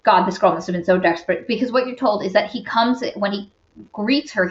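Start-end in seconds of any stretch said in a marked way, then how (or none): none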